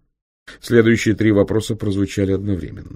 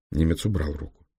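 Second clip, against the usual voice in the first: ordinary delivery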